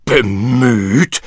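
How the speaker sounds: furious shout. Acted. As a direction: throw the word back at him in rage. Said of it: "schrie der Tyrann wütend".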